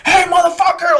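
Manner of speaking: very high, hollered call-out; imitated sergeant shout. Sergeant calling out a marine, mock shout. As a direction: shout it across the parade ground.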